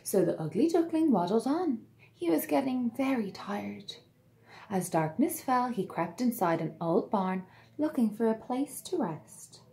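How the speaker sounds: put-on lively read-aloud to children; storybook narration. A narrator's reading. Narrator telling children the tale, bright and lively. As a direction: read it like bedtime story time.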